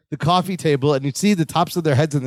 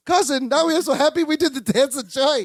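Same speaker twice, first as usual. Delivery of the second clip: put-on Balky's accent; 8 semitones higher than usual.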